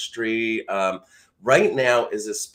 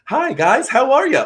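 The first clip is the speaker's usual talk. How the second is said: bright, much higher, louder greeting; squeaky high greeting; 14 semitones higher, 9 dB louder than usual. Shown as a demonstration. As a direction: pitch way up for a chirpy hello. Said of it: "Different voice tones".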